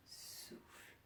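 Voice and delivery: very soft, voiceless exhale on the word; breathed-out cue. Shown as a demonstration. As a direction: breathe the word out slowly and softly. (soufflé tout bas, en expirant)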